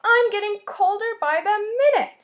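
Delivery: high, loud panicked pleading; trapped and scared. Acted. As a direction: call out desperately through the fridge door. Trapped mouse calling for help.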